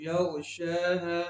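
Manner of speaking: slow, long held शा in यग़शाहा; stretched vowel. Shown as a demonstration. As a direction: draw out the शा of यग़शाहा. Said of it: "उसी तरह पढ़ेंगे". (यग़शाहा का शा खींच कर)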